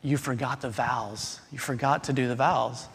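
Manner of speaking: mock hushed aside to the minister; soft retold whisper. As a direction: imitate the bride whispering quietly to the minister. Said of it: "she whispered under her breath".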